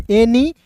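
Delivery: "एनी" ending in a clear ee; right pronunciation. Shown as a demonstration. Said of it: "उच्चारण ई के रूप में". ("एनी" का उच्चारण)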